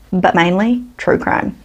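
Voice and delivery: normal talking voice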